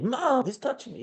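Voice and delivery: put-on high squeaky tattling kid voice; whiny tattling little sibling. Shown as a demonstration. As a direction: exaggerate it like a whiny little brother. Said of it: "Add physicality".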